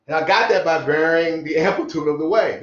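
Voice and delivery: ordinary talk, nothing marked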